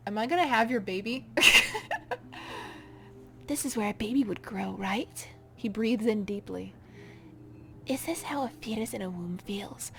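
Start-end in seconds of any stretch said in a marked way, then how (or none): 1.3-2.8 amused laugh. Acted. Creature amused by question. 7.8-9.9 eerie whisper. Acted. Creature musing inside body.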